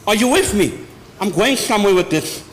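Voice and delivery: ordinary talk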